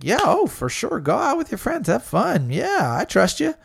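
high mock cheerful permission; mock sweet boyfriend. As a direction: play the overly easygoing boyfriend. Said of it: "like L J said".

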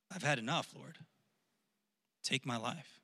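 soft, spent plea to God; weary prayer. Acted. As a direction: pray it quietly, worn out and giving up. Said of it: "prayed that he might die".

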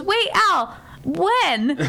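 high, puzzled call; imitating a woman caught off guard; retold calling after someone. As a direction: call him back in confusion. Retold calling after man leaving.

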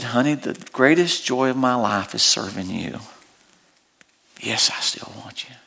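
tender reassurance. Retold or imitated. Husband's tender reply to wife.

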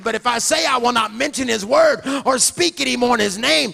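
loud, fervent preaching shout; full-force preaching. Acted. Repeated Jeremiah at full force.

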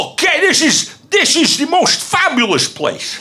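high, put-on Dutch accent. Performer describing a country for guessers, in a Dutch accent. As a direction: ham up a dubious Dutch accent.

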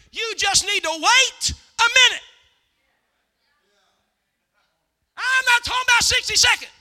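loud, high, shouted exhortation; full-force preaching. Real, not acted. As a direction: belt it out to rouse the congregation.

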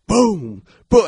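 high, loud "boom" sound effect; mimicked impact noise; imitated banging boom. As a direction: punch out each boom like a heavy thud. Imitates van being kicked from inside.

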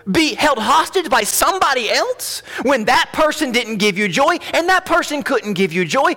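very high-pitched, urgent pulpit challenge; impassioned preaching. Real, not acted. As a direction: press the congregation with rising questions.

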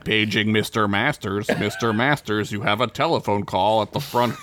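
flat announcer voice, lightly done; flat echoey PA page. Pretend. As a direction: read it like a tannoy page. Mock PA page from movie.

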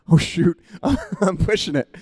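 "oh shoot" with a laugh. Retold or imitated. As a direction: caught out, laughing it off. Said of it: "So I'm like".

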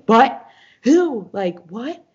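high, startled retold questions; shocked disbelief. Friend reacting to news of a death, shocked disbelief. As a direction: fire out startled questions, first ones loud.

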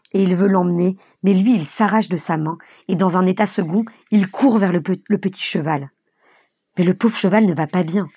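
usual speaking voice

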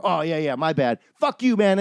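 mock apology. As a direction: sneer the sorry, then bark the insult.

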